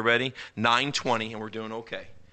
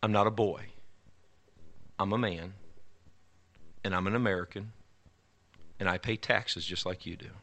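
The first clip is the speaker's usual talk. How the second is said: quiet menace; 3 semitones lower, 8 dB quieter than usual. Retold or imitated. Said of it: "He said".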